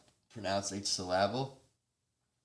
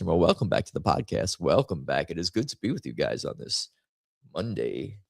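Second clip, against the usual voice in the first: lower, louder host read, quick; low louder announcer welcome; podcast host voice; 6 semitones lower, 6 dB louder, faster than usual. Acted. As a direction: slide into podcast mode. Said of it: "Shifting into podcasting".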